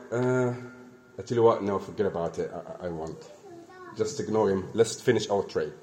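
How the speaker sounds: low, quiet mock reluctance; dismissive. Trader declines Abbas's offer to talk, quietly dismissive. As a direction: brush it off under your breath, voice dropped.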